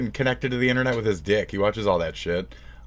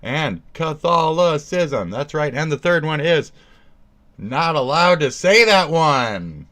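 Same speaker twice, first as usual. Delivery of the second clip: loud, raised, drawn-out announcing; hammed-up game-show announcement; mock quiz reveal; 6 semitones higher, 6 dB louder than usual. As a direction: proclaim each answer to the whole crowd. Mock quiz reveal of forbidden answer.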